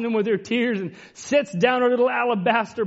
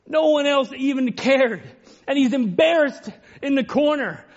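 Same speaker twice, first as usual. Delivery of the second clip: raised, higher pulpit voice building with feeling; fervent sermon delivery; impassioned preaching; 7 semitones higher than usual. Acted. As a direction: preach it out with fervour, climbing. Preaching rises again.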